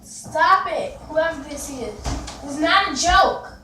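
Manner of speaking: loud firm telling off; angry scolding. Real, not acted. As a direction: warn them off, loud and serious. Scolding unseen prankster.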